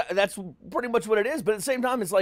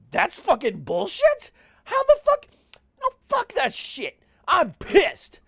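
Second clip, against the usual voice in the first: retold outrage; 10 semitones higher, 3 dB louder, slower than usual. Retelling his grown-up outrage.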